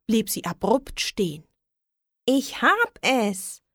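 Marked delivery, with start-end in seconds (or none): 2.2-3.6 high, drawn-out cry of discovery; calling out. A narrator's reading. Witch suddenly has an idea, calling out slow and high. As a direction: slowly exclaim a sudden idea, pitched up. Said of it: "rief sie".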